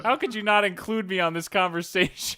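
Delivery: hammed-up offended friend; mock outrage. Imagining friend bursting in, mock indignant and loud. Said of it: "be like".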